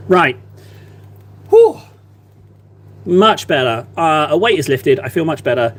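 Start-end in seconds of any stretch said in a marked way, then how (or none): 1.5-1.8 relieved whew. Real, not acted. Relieved exhale.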